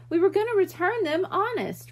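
high, pleading cry; a kid pleading, close to tears. A narrator's reading. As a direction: beg them to believe you. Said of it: "Ralphie cried".

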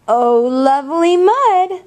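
high, light duck voice; a hint of a squeaky duck. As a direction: lift it up for a silly duck. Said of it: "said the duck".

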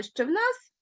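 normal talking voice

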